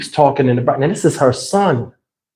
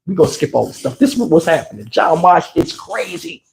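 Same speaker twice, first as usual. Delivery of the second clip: ordinary talk, nothing marked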